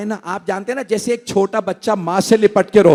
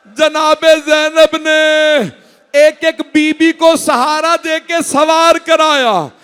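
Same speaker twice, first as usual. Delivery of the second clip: preaching at full force; 4 semitones higher than usual. Acted. Preacher to mourners, high chanted preaching. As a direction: lift the mourners with a wailing tune. Majlis climax narration.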